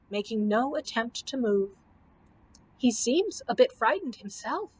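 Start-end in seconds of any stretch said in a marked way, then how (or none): none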